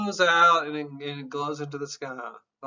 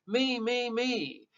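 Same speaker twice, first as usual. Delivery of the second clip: mock stubborn insistence; 7 semitones higher than usual. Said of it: "It's like".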